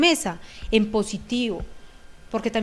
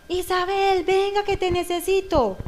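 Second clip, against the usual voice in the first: imitated demanding call; 8 semitones higher than usual. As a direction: bark the child's name like an order. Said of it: "Es muy diferente".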